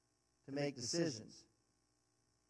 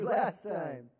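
ordinary talk

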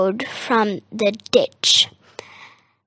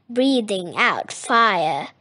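ordinary delivery

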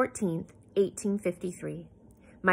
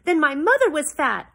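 loud, high outburst; burst out; 8 semitones higher, 8 dB louder than usual. A narrator's reading. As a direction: blurt it out loud and hurt. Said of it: "I burst out".

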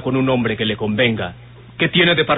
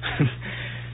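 soft breathy laugh; 10 dB quieter than usual. Acted. Alejandro laughs at Teresa.

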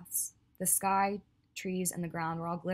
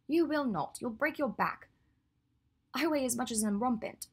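high, louder scoffing protest; 7 semitones higher, 4 dB louder than usual. Acted. Hermione refuses to be carried by Draco, scoffing and high. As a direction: snort at the idea, playfully indignant. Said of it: "she snorted".

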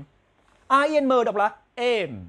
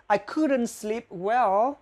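slow demo; much slower than usual. Shown as a demonstration. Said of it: "slow model sentence".

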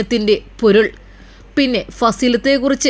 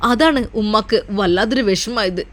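plain reading voice

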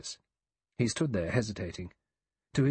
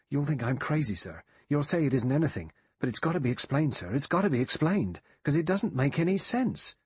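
higher-pitched, frantic insistence; agitated pleading; 5 semitones higher than usual. Acted. Frenzied butler begs the doctor to take him seriously, agitated. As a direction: plead urgently for an explanation.

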